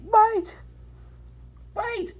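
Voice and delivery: very high, loud call after. Acted. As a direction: shout after the runner. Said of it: "Nine called after".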